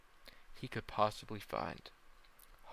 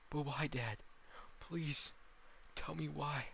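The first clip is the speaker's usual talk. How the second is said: higher-pitched plea; raised, imploring voice; pleading son; 6 semitones higher than usual. Acted. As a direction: beg your father for an answer. Son pleads with dying father.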